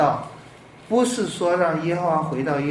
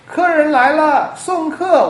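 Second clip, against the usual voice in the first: high, loud doorman's call; 喊客吆喝; 12 semitones higher, 7 dB louder, slower than usual. Acted. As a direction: call it out like announcing guests across a restaurant. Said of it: "我就是那个喊叫的人". (高声吆喝)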